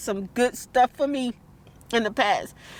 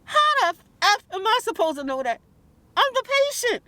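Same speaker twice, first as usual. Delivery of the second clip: indignant rant; 9 semitones higher than usual. Real, not acted. Angry patient venting about the dentist, high indignant outburst. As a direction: fire it back, fully exasperated.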